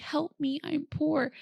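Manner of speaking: light mock whimper of a movie line; whiny Bridesmaids quote. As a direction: play up the poor helpless act, lightly. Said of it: "I would be like".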